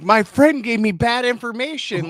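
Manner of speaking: loud, shouted excuse; pretend excuse. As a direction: protest your innocence loudly.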